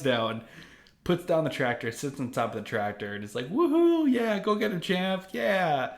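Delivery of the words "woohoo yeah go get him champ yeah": high excited cheering; mock cheer from the crowd. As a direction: whoop it up like a fan in the stands. Goku cheering from tractor.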